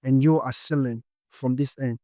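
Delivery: normal talking voice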